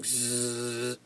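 soft, low sleeping "zzz"; snoring buzz. Acted. Reader voices Garfield snoozing on a tree limb, soft buzz. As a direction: buzz quietly like a cartoon sleeper.

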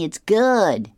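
high and loud, hammed-up boy praising; booming high praise for Chutney; Curtis voice.

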